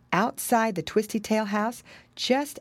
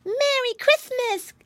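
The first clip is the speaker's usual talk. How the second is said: high, stretched piglet cry; excited little child voice; Flop Ear. A narrator's reading. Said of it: "cried Flop Ear".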